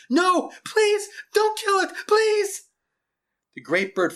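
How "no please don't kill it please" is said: put-on very high, loud begging child; desperate pleading. A narrator's reading. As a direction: scream your plea to stop the killing. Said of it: "Marianne pulled at the tall man's arms and pleaded".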